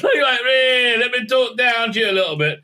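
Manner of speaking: high, hammed-up condescension; mock talking down. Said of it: "talking down to Mark Addy".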